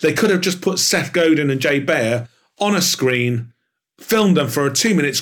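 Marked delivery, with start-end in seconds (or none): none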